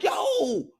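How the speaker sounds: very high shouted yo; startled yell. Retold or imitated. Reacting to the stream, a sudden high shout. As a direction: bark it out in alarm. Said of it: "I'm like".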